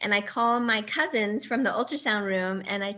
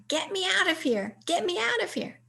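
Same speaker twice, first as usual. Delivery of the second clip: father in dream; 10 semitones higher than usual. Retold or imitated. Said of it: "My father came to me in a dream".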